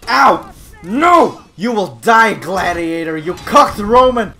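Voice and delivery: loud, high vengeful shouting; hammed-up raging warrior; battle fury. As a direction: bellow threats between cries of pain. Shouts as Roman fighting rival.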